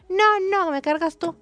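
high, loud mock tantrum; imitated toddler screaming. Daughter refusing the cart in the supermarket, imitated high yelling. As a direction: act out a little girl yelling her refusal. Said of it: "empezó a, a gritar".

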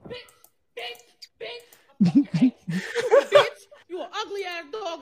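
laughing mock anger at dog; laughing insults. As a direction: cackle through the insults.

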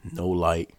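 ordinary talk, nothing marked

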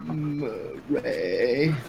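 low, drawn-out Murray; Joker impression. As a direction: draw the name out deep and slow. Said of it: "That's how he says it".

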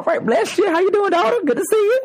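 high sweet voice for the dog; talking to the dog, greeting her in a high voice. Real, not acted.

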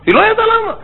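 "למה" flung up very high in disbelief; amazed. Retold or imitated. Mother still cannot understand why her dishes spoil, amazed. Repeats with big pitch leap.

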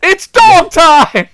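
loud, slow, high announcer yell; hype shout. Acted. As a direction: stretch it out like a hype call. Hyped commentator catchphrase.

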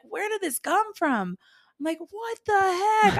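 high disbelief. Retold or imitated. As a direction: stretch it out in shock.

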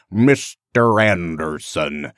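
slow, low, over-enunciated Midwestern American drawl; Midwestern American accent. Retold or imitated. Fan imitating the villain's overdone Midwestern American accent, low and slow. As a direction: draw out "Mister Anderson" in an exaggerated Midwestern American accent. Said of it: "this weird kind of Midwestern American".

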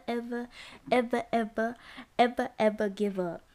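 building dramatic repetition of ever; dramatic repeated ever. Shown as a demonstration. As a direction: pile on the evers for drama. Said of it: "I'ma be dramatic right now".

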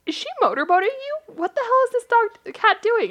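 high, loud, incredulous squeal; friend's high squeal. Retold or imitated. Friend squealing at the cat nuzzling her. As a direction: squeal it like you can't believe it. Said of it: "She goes".

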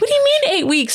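high-pitched mock disbelief; retold shock. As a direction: squeak it out as if stunned. Retells her shocked reaction to news.

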